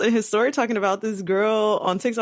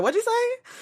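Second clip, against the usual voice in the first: much higher, indignant grandma query; affronted grandma. Acted. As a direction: pitch it way up like a grandma taking offence. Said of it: "She gonna be like".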